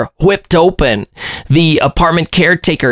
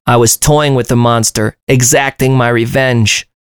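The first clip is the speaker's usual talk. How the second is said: usual speaking voice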